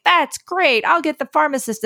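high, bright-eyed cheerful clerk; chirpy clerk voice. Retold or imitated. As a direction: play the overly cheerful cashier. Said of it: "She's like".

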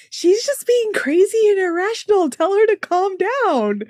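high-pitched mock exasperated husband; dismissive partner impression. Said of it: "And then he's like".

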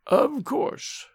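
high, drawn-out words; old man taking his time over each word; very slow. A narrator's reading. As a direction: say it slowly and high. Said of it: "very slowly".

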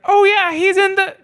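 put-on very high sweet old lady voice; high doting grandma voice; sweet old wife. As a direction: gush like a delighted elderly wife. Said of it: "she's like".

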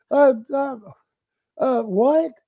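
high stammering disbelief; stunned confusion. Retold or imitated. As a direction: stumble as the joke sinks in. Player realizing he was pranked.

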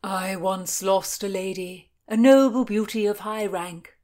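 lightly gushing over a lost beauty. A narrator's reading. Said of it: "Sir Henry gushed".

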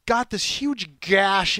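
high, loud, incredulous burst; exasperated disbelief. Retold or imitated. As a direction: tell it with shocked disbelief. Retelling a tire damage mishap.

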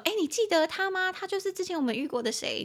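high, curious question voice; friend asking. Pretend. Friend asking if you remember someone, high and curious. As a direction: act a friend asking eagerly. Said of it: "朋友在问你说".

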